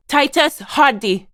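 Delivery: loud, high, slow reprimand of the full name; commanding shout. Acted. Speaker pulls up Titus Hardy by name, commanding shout.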